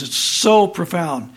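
normal talking voice

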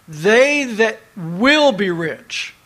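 slow reading with "will" held long; stress on "will". Shown as a demonstration. Preacher returns to the verse, stressing "will" slowly. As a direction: underline "will" with a weighty stress. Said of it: "you need to underline that word will".

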